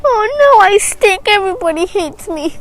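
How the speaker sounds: high, wailing pretend sobs; mock crying. Poor stinky elephant alone at night, mock wailing. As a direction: cry it out like a poor little elephant, hammed up. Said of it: "poor elephant in the night cried and cried".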